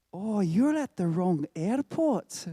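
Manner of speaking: imitated check-in clerk with a Scottish lilt; attempted Irish accent, a bit Scottish. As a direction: put on a rough Northern Irish accent that slips into Scottish. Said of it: "oh, that's a bit Scottish".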